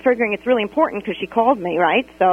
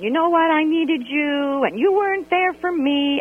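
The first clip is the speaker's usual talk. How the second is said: pouty complaint; 6 semitones higher than usual. Retold or imitated. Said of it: "all pouty about it".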